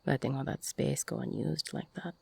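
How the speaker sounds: normal talking voice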